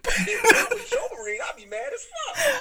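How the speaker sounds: high, laughing almost crying. Real, not acted. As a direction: crack up while saying it. Laughing hard.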